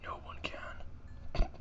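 slow ominous whisper; menacing whisper. Pretend. Menacing aside.

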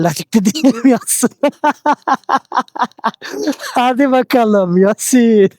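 high laughing voice. Real, not acted. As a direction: laugh through the words. Laughing while telling viewers.

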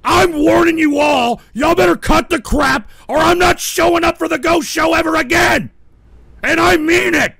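high, loud shouted warning; furious warning. Real, not acted. Host threatening to quit the show, furious shouted warning. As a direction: bellow the ultimatum.

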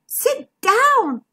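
high-pitched alarmed cry. A narrator's reading. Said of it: "cried Bertie".

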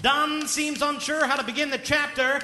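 sports commentator calling the action; play-by-play commentary. Acted. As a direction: call the writing like a fight. Play-by-play of writing as boxing.

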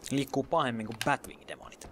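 ordinary delivery

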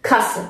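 کاسه with a short a; German کاسه said as "kasse", brief first vowel. Shown as a demonstration.

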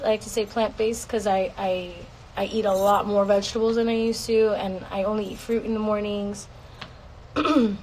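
ordinary talk, nothing marked

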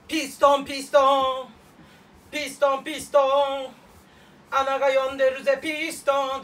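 loud hammed-up character voice; comic impression. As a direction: shout it out like a crude bit. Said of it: "人のモノマネでした".

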